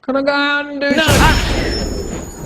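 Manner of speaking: put-on high whining child voice; whiny kid. Whiny kid begging teacher to go out, high and whining. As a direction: whine like a bored child begging. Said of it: "a whiny kid going".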